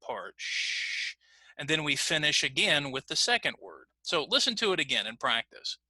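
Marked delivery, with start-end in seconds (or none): 0.4-1.1 a long, soft SH hiss; held ch. Shown as a demonstration. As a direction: hold "sh" out softly as pure breath. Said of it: "we kind of hold the ch".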